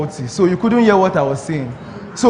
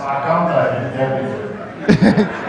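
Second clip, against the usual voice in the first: laugh; 4 semitones lower than usual. Real, not acted. Laughs after the scam reveal.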